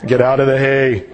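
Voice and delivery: low, stern police loudspeaker order; dad on loudspeaker. Retold or imitated. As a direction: boom it out like a cop over the car speaker. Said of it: "He gets on his loudspeaker".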